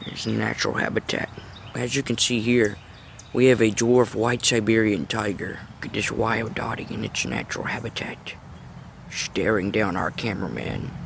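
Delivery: low, hushed wildlife narrator voice; nature documentary. Acted. Mock wildlife film intro.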